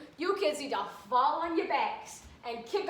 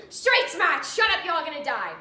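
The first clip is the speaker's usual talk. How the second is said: barked safety tips, loud and high; mock drill instructor; 4 semitones higher, 9 dB louder than usual. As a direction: bark the tips like a tough cop speaker. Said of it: "Bittenbinder's tips".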